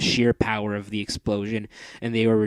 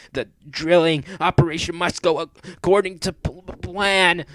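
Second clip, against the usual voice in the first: higher, stammering villainess voice; Mistress of Darkness; 7 semitones higher, 6 dB louder than usual. Acted. Mistress muttering her plan to herself, high and stammering. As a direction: scheme aloud, tripping over words.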